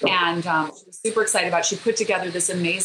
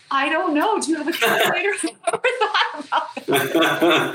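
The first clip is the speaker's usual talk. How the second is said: high, flustered quote through laughter; laughing retold quote; 9 semitones higher than usual. Retelling the floored fundraiser's answer to the donor, laughing. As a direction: laugh your way through the punchline.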